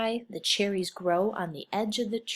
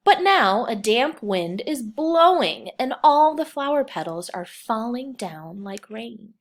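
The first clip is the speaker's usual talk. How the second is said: louder storybook reading; 4 semitones higher, 10 dB louder than usual. Acted. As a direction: read the windy spring part out loud, with a lift. Teacher reads picture book aloud.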